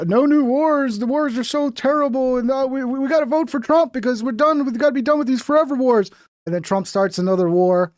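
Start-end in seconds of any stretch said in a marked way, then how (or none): 0.1-6.1 higher whiny mock slogans; mocking Tim.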